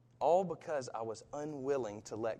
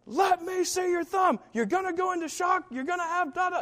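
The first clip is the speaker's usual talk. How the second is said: high, loud urgent dad yelling; father shouting to see the child's thumb; screaming dad; 4 dB louder than usual. Retold or imitated. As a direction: bark urgent orders at the scared kid. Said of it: "basically screaming at me".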